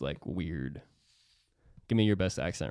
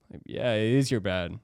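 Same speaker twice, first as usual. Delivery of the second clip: ordinary delivery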